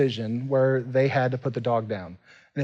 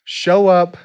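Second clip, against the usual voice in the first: loud, raised preacher's call to the room; fired-up sermon voice pushing people to join groups; preaching at full force; 5 semitones higher, 6 dB louder than usual. Acted. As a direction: drive it home to the congregation like a pastor. Preaching to the congregation.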